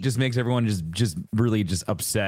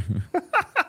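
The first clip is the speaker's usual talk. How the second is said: a burst of high laughter. Real, not acted. Friend laughing at the coffee machine mix-up, high laugh. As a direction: laugh high at your own joke.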